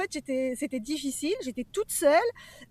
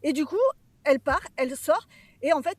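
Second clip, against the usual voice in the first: ordinary talk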